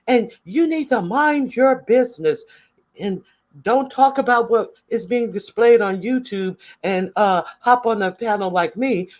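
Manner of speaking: high mocking impression of a bickering woman; hammed-up high-pitched mimicry; mocking quote. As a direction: squeak it out, mocking her.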